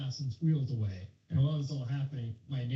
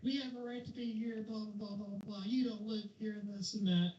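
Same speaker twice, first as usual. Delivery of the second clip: mock freaking out; 12 semitones higher, 7 dB quieter than usual. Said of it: "starts freaking out".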